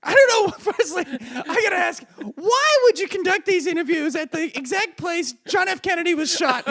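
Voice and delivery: high indignant interviewee; man on the street. Pretend. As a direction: demand answers in a high put-on voice. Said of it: "I'm the man on the street".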